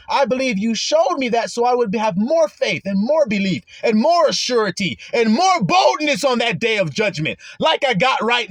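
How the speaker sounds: rising to loud, high, fervent pleading; bold pleading. Pretend. Believer pleading at judgment, building to a fervent shout. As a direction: argue your case to God with growing fire. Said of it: "I'd be like".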